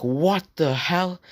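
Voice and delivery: high, drawn-out shocked cry. Retold or imitated. Kid reacting to being stabbed, mock shocked cry. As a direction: react like you just got stabbed.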